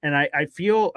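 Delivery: ordinary delivery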